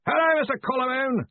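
very high, hearty long-distance yell. A narrator's reading. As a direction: holler a greeting to someone far away. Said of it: "yelled Ridcully".

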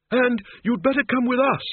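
high shout after a departing friend. A narrator's reading. Windle shouts high to Schleppel disappearing in the mist. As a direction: call after him as he vanishes. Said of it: "Windle shouted".

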